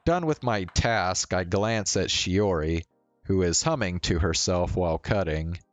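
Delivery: ordinary delivery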